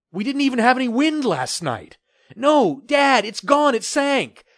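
high and loud protest; agitated. Acted. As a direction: insist loudly and high. Father and son arguing loudly.

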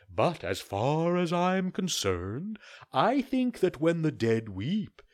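slow, polite owl apology; a hint of an owl doctor, unhurried; lightly voiced owl. Owl gives opinion.

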